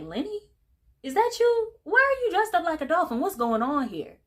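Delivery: high, loud and shocked; surprised father. Retold or imitated. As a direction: shriek the question in disbelief. Shark father spots his son.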